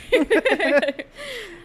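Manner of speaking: high burst of laughter; loud laugh. Real, not acted. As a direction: let the laugh break out. Laughing at own joke.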